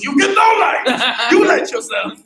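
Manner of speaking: high, loud imitation of a club owner; loud comic dismissal; mock indignant refusal. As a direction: refuse outright in a hammed-up voice.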